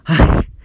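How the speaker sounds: sudden loud breathy laugh; burst of laughter. Real, not acted. Remembering A J's face in class, a sudden real laugh. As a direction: crack up at the memory.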